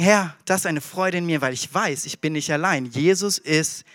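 soft, low, slowing prayer; whisper-close reassurance to yourself; comforting prayer for the lonely listener; quiet trusting prayer. Acted. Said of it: "dann kannst du sagen".

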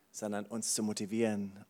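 normal talking voice

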